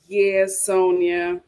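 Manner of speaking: ordinary talk, nothing marked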